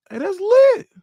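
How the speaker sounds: very high, loud held cry; excited whoop. Real, not acted. Streamer hyped about twelve thousand subscribers, whooping. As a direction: let it ring out in joy. Celebrating milestone.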